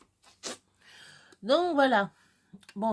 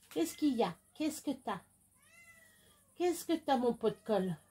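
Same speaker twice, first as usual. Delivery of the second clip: pet talk; 5 semitones higher, 3 dB quieter than usual. Real, not acted. Cooing to her pet.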